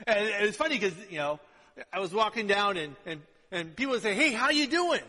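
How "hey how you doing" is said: high, loud, hearty hello; big retold greeting to a passerby; friendly Southern greeting. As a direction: imitate an outgoing Southerner saying hi. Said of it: "that's southern for hi".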